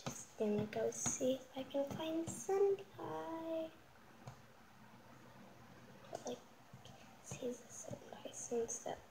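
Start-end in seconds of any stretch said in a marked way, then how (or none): 6.1-9.0 hushed secret whisper. Acted. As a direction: whisper it under your breath. Whispered aside.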